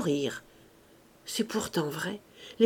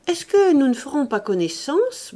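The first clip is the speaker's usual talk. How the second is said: higher, louder, faltering question; stammered request; 6 semitones higher, 5 dB louder than usual. A narrator's reading. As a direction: venture it nervously. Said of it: "balbutia Fauchelevent". (demande balbutiée, un peu aiguë)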